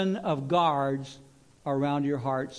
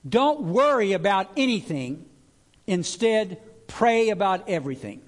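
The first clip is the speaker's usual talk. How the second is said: louder, ringing scripture read; proclaimed verse; 4 semitones higher, 6 dB louder than usual. Shown as a demonstration. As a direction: read it out boldly to the room. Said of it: "the Living Bible says it like this".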